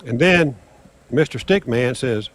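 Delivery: ordinary talk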